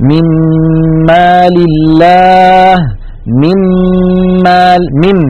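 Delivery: من مال chanted slowly with a long, nasal doubled مّ; slow Quranic recitation, من مال as "mim-maal" with the mim held. Shown as a demonstration. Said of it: "ننتقل من الميم الأولى إلى الميم الثانية مشددة".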